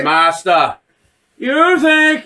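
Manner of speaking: imitating a damaged voice, breaking upward; mock villain with glitching voice; broken voice box. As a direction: voice cutting in and out, hammed up. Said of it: "the voice is cutting in and out".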